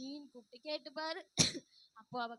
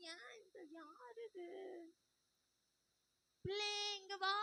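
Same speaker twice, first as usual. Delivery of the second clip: higher, lightly put-on granny voice; old grandmother; 7 semitones higher than usual. Old grandmother calling a girl over. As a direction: call the child like a frail old lady.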